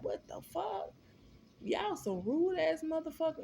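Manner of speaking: retold indignant cry at rude guards; outraged disbelief. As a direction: squeal it in stunned outrage. Outraged at the strip search.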